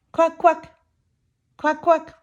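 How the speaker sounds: high, loud quacking; duck quack. Acted. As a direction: quack like splashing ducks. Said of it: "Ducks are splashing in the lake".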